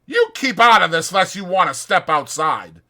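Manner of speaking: loud, challenging threat; Curly threatening Carlson, loud. Acted. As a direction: dare him to fight.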